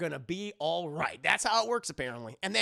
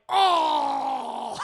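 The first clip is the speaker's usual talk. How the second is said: high, loud anguished "oh"; upset mock wail; 8 semitones higher, 8 dB louder than usual. Husband hearing she's pregnant, hammed-up upset wail. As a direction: wail in exaggerated dismay. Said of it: "He's so upset".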